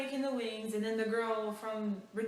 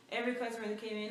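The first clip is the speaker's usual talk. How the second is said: ordinary talk, nothing marked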